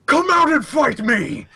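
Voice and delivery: high acted battle roar. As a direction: roar out a challenge to a hidden foe. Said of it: "He roars out".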